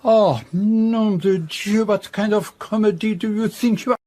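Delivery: outraged and incredulous; exasperated outburst. Acted. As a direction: recoil in horror at the costume. Poirot aghast at the disguise.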